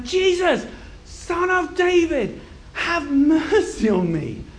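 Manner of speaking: high, slow, full-voiced cry. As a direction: shout each phrase out long and high over a crowd. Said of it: "So he shouts even louder".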